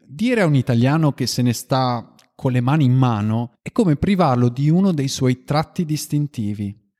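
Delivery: ordinary delivery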